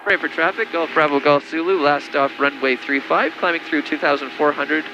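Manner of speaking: radio call. Acted. Pilot makes radio position call.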